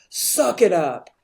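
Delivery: loud, imitated stern-dad order; tough cop dad. Cop dad telling crying daughter off, loud and tough. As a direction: mimic a tough father toughening up his kid. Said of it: "her dad being this tough cop".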